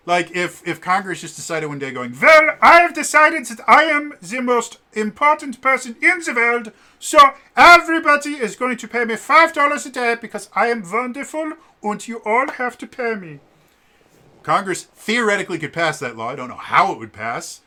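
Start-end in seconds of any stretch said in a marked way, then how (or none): 2.2-13.4 put-on much higher and louder, a self-important non-American accent; pompous fun accent. Congress demanding five dollars a day, in a pompous put-on non-American accent. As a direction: lord it over everyone in a silly foreign accent. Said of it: "they're fun accents".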